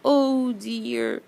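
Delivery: dismayed cry. Acted. Bee finds hive across the stream, dismayed. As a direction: sigh out the bee's dismay. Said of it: "cried the greedy bee".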